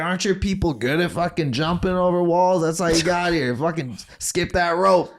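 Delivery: high, mocking gym coach voice; teasing old coach. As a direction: rib them like a crude old coach. Johnny's racist teasing.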